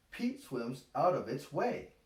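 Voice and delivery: plain reading voice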